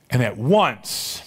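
"And at once" drawn out slowly, ONCE stressed; slow down and lean on "once"; emphasized phrase. Shown as a demonstration. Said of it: "Mark's favorite word".

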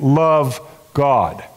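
ordinary talk

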